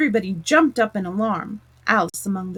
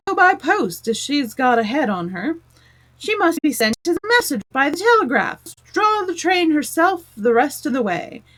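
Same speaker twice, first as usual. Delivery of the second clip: much higher, louder crowd; many voices calling over each other; chorus of voices; 6 semitones higher, 4 dB louder than usual. A narrator's reading. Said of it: "the other voices went on".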